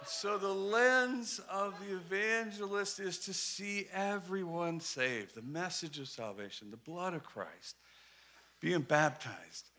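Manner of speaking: slow, hushed, low summary; solemn preaching. Real, not acted.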